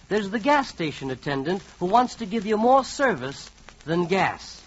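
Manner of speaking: usual speaking voice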